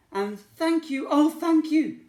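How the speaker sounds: high, loud heartfelt thanks; overjoyed witch thanking them; grateful cry. Acted. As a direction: pour out gratitude. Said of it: "the grateful witch cried".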